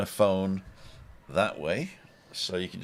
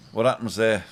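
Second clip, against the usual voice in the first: ordinary talk, nothing marked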